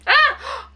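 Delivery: very high sudden shriek; startled scream. Real, not acted. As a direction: yelp in shock. Sudden fright in game.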